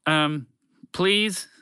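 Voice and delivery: high, hesitant plea; a touch of panic in begging; mild panic. A narrator's reading. Broadcast voice begging the group for rescue, mildly panicked. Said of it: "added with some panic".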